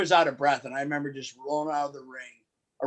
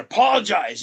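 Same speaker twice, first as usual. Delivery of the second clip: sharp barked order to apologize; commanding shout; 7 semitones higher, 7 dB louder than usual. Retold or imitated. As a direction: yell it like a heel manager. Heel manager ordering ref to apologize.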